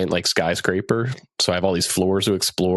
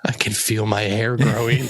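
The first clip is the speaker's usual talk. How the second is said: laughing pet voice; 3 semitones higher than usual. Pretend. Friends voicing the cat Zelda, laughing. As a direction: giggle through a silly pet line. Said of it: "Zelda would be like".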